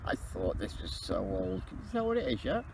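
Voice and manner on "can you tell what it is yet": high, light TV presenter voice; sing-song telly catchphrase. Pretend. TV catchphrase quoted twice.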